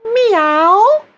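high, gentle rising meow; smooth twang meow. Shown as a demonstration. Coach demonstrating twang, a gentle high meow. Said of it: "Just try it a few times".

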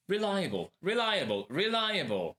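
"reliable" three times, high-pitched, each with a falling end; whole-word drill. Shown as a demonstration. Teacher completes the backwards exercise for learners, high drilled repetition. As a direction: model "reliable" high and evenly repeated. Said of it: "Zacznij od końca".

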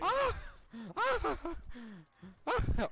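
very high loud screams; panicked scream. Real, not acted. Being chased and attacked.

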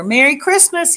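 calling a cheerful greeting at the door; called out. A narrator's reading. Old Armadillo calling to carolers at his door, bright call. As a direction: call it out to whoever's there. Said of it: "he called".

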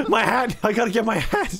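high, laughing cry of alarm; mock panic. Gamer scrambling after a lost hat, mock panic. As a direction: cry out as if losing something precious, laughing.

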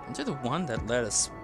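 ordinary delivery

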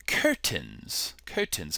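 low, soft British accent; quiet amateur British imitation. Shown as a demonstration. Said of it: "give you my British accent".

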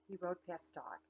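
usual speaking voice

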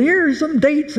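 high, cheerful calling out; playful call. Retold or imitated. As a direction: hand it out with glee. Tossing dates to the crowd.

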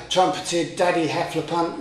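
ordinary delivery, nothing marked

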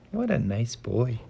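ordinary delivery, nothing marked

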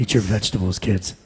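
low hero voice as a dad; Adam Jensen. Acted. Action hero telling kids to eat, low voice. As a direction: drop it low, a father's order in character. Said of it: "why are you talking like Jensen?".

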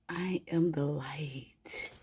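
slow, reverent whisper; awed whisper. Real, not acted. Reader to viewers, slowly whispering her realization. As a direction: breathe it out slowly, in awe. Repeats her line in awe.

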